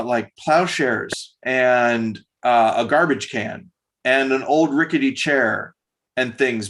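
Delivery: ordinary delivery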